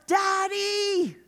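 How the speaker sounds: high, calling out Daddy; childlike call. Acted. Child calling to God, high and eager.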